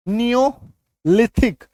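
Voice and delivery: "नियोलिथिक" very slowly, split after नियो; syllable demonstration. Shown as a demonstration. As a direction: break "नियोलिथिक" into two parts, ee-o clear. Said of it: "ई". ("नियोलिथिक" रुककर, बहुत धीरे)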